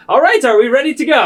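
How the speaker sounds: high, loud and fast cheerful call; eager Romulus. Acted. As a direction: bounce in excited. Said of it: "Romulus be like".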